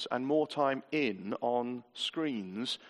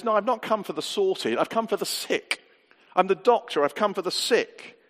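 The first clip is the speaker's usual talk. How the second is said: raised, louder preaching quote; emphatic declaration; 5 semitones higher, 5 dB louder than usual. Retold or imitated. Preacher quoting Jesus on whom he came for, emphatic. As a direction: declare it firmly, pitched up.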